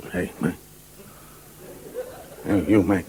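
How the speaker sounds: very low furtive American voice; bar stranger. Acted. Fella approaching someone in a New York bar, low furtive voice. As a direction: drop it deep, sidling up. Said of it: "A fella comes in".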